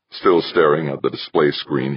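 ordinary delivery

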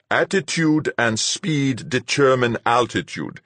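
slow, high, incantatory recital; reciting a formula. A narrator's reading. Fuchs coaching the pilot as if reciting a formula. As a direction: intone it like an ancient formula. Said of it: "reciting an ancient formula".

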